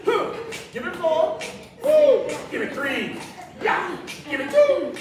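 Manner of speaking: high, loud pumped-up rep count; excited countdown. Acted. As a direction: whoop between counts to fire them up. Counting down reps, whooping.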